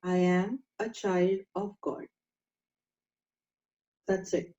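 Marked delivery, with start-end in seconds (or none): 0.0-2.1 slow, gentle inner affirmation; calm affirmation. Shown as a demonstration. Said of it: "just keep thinking".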